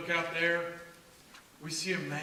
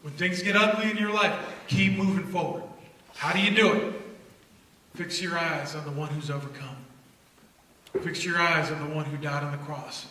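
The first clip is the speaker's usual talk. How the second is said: soft preaching; 4 semitones lower, 3 dB quieter than usual. Acted.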